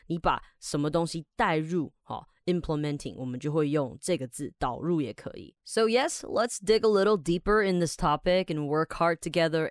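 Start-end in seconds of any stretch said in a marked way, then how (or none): none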